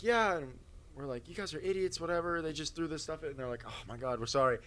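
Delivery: ordinary talk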